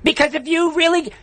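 high, heated rant; really worked up and indignant. Real, not acted. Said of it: "I don't wanna get worked up".

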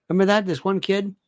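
usual speaking voice

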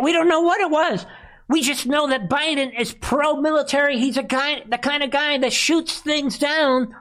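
high, mocking sarcasm; sarcastic. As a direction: lay the sarcasm on thick. Sarcastic mock praise.

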